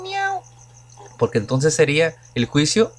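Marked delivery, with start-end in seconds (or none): 0.0-0.4 high squeaky meow, much higher; cat meow. Retold or imitated. Teacher imitates a cat meowing for students, high playful meow. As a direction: mimic a cat's meow, pitched way up. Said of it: "un perro haciendo miau".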